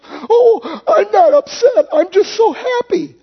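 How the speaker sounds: high-pitched, hammed-up tearful wail; sobbing wife. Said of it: "the wife is sobbing".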